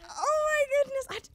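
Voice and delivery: high, loud, drawn-out disbelief; shocked gasp. Retold or imitated. Teen girl on the phone to [name], high loud disbelief. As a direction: squeal it like you can't believe it. Stunned reaction to [name]'s call.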